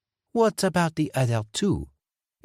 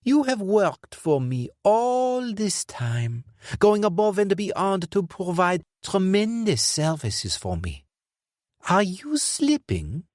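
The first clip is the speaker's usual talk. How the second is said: regret and cynicism; 8 semitones higher than usual. Acted. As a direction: sigh with weary scorn. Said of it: "heavy sentiment of regret and cynicism".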